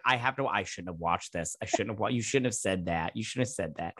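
low, soft regretful grumble; low quiet mock regret; mock regretful mutter. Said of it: "I was like".

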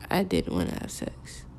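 retold confession, low and barely voiced; small quiet admission. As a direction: let it out softly, almost under the breath. Retelling her painful, reluctant answer.

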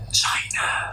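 stage whisper of a secret. Acted. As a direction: hush it like a naughty secret.

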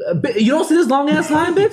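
high, incredulous retold snap; mock outrage at the long line; mock exasperation. S1 retells his outburst at the cashier, high and incredulous. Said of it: "I'm like".

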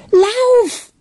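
high, loud urgent cry; scream. A narrator's reading. Charlotte sends Ben fleeing from the nutcrackers, screaming. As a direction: scream it to make him run. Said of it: "schrie Charlotte".

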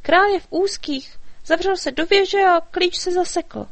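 high, eager fairy voice; little fairy, worried. A narrator's reading. Fairy explaining the king's trouble to the dragon, high and urgent.

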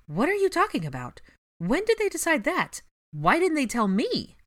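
high, loud angry rant; angry rattled questions. Acted. Ginny furious at being left behind, loud and high. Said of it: "Ginny rattled off".

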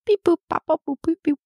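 high beeping robot code. Pretend. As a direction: beep it out like a little robot. Secret code in robot beeps.

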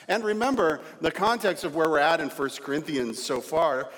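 ordinary delivery

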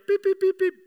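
high monotone beep imitation; short high beeps on one note; car alert beeping. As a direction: sound a little lane-warning alarm. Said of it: "gives you a little beep".

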